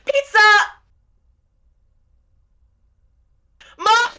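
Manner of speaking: put-on shrill high yell of a kid calling mom. Kid yelling to mom about the door. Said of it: "And he goes".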